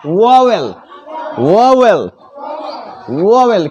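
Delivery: vowel said as "voh-vel" with a clear v and o; correct vowel. Shown as a demonstration. Teacher showing children how vowel is said. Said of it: "लेकिन क्या बोलना है".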